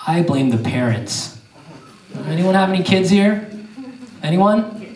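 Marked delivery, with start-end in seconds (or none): none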